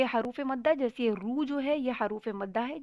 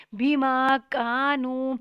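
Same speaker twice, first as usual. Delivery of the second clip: slow, louder tajweed chant; slow Quran recitation; 6 dB louder, much slower than usual. Shown as a demonstration. Teacher reciting slowly for students. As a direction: draw the long vowels out.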